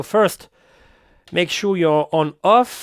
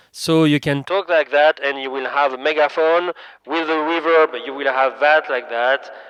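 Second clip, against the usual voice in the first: megaphone effect. Shown as a demonstration. Presenter showing the mixer's megaphone option, megaphone effect. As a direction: voice through a megaphone. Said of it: "you have megaphone option".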